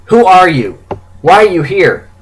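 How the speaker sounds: loud, high angry questioning; sharp rise into anger; sudden anger. A narrator's reading. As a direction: burst out demanding answers from an intruder. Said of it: "I suddenly become angry".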